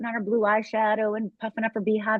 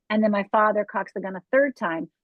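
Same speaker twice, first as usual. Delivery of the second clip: ordinary talk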